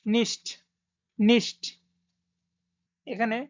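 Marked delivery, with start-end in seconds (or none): 0.1-1.6 নিষ্ট said like German nicht, sh-t ending. Shown as a demonstration. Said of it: "এখানে নিষ্ট হবে".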